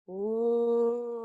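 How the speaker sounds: long held "ooh", one steady note; imitated eerie sound. Retelling what she heard in the dark, imitated long ooh. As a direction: draw out a single hollow "ooh". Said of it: "all I hear is".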